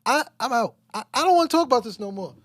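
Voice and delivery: loud, high-pitched interrupting; agitated protest. Real, not acted. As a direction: cut in loudly, refusing to go on. Cuts in to walk out of topic.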